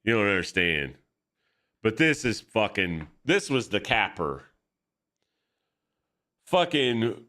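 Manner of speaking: ordinary talk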